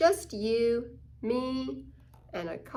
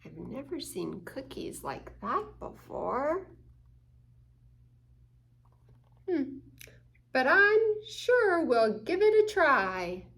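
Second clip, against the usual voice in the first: curious openness; 3 semitones higher than usual. Acted. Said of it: "Open-minded means".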